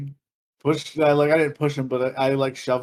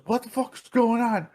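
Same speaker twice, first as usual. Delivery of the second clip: high, mock-alarmed announcer; 8 semitones higher than usual. DJ stopping the music to call bouncers, alarmed. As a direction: call for security like a startled DJ. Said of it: "D J literally over".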